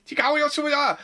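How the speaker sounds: high, hammed-up kid shout; mock shout. Retelling a random kid in the park, a high mock shout. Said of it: "shouts something like".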